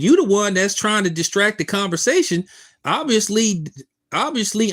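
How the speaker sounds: loud, high and agitated; heated argument. Real, not acted. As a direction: push back hard on the heckler. Arguing with a chatter.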